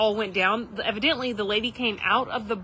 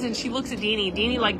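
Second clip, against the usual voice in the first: ordinary delivery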